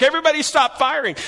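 high, quick retold order to stop firing; urgent call. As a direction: shout the order to hold fire, in a mild retelling. German soldier calling out.

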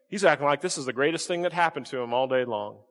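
ordinary delivery

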